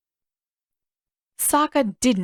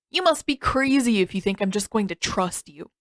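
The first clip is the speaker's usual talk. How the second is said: ordinary delivery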